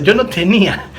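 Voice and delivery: ordinary talk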